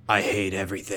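lightly voiced brooding hedgehog; a hint of a low, brooding edgelord; dark brooding voice. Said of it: "In his dark, brooding".

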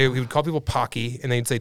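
usual speaking voice

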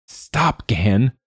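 squeaking high through the giggles; barely able to get it out for laughing; strangled, laughing plea; choking on laughter. Acted. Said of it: "Quoth choked".

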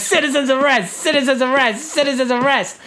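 high, mock-official proclamation; hammed-up insistent announcement; repetitive declaration. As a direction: declare it over and over. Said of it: "he keeps saying it over and over again".

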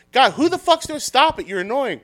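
high-pitched mock outburst; imitated fed-up onlooker. Someone in the crowd fed up with barking, mock exasperated.

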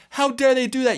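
high, loud mock outrage; indignant outrage. Someone who just got hit, crying out in outrage. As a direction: blurt it out, offended and indignant. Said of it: "you are now saying".

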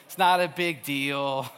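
low, very slow mock-soothing drawl; calm voice. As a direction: imitate an utterly unbothered friend, stretching the words out. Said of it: "such a calm voice".

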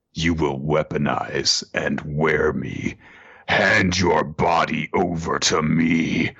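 slow, deliberate kaiju demand; slow, looming monster order; commanding monster. Acted. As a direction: draw each word out with menace.

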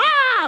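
high, loud squeal of joy; excited squeal. Retold or imitated. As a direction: let out a giddy, excited shriek. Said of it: "I was so excited".

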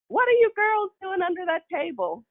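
high calling mom voice; a mother's high sing-song call. Retold or imitated. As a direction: call down to the kids under the table. Mom calling under the table.

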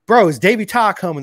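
hopeful fan calling out to the paddock; eager question. Retold or imitated. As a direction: ask it like you can't wait to know. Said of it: "I was asking everybody in the paddock".

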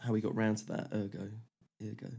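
ordinary delivery

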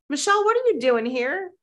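high, delighted "what are you doing here"; surprised greeting. Retold or imitated. Producer friend walks by and greets her, surprised. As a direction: play a friend happily spotting her.

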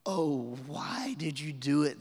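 slow, drawn-out groan of dismay; groaning sailors. Acted. Terrified sailors answer the runaway Jonah, groaning. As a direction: groan it out slowly, aghast at him. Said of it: "they groaned".

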